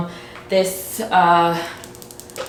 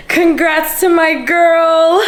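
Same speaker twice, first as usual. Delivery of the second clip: excited cheer; 9 semitones higher, 4 dB louder, slower than usual. Real, not acted. Cheering friend at wedding.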